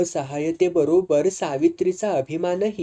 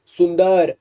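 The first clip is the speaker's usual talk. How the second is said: louder call of a name from the sickbed; 3 semitones higher, 6 dB louder, slower than usual. A narrator's reading. Sick Vitthal calls after Sundar as she runs out. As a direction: call her back before she leaves. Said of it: "अंथरुणातून हाक आली".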